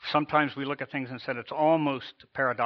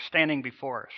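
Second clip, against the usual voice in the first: ordinary talk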